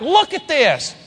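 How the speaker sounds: a disciple who can't believe his eyes; astonished Peter; shocked Peter. Retold or imitated. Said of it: "He was shocked, like".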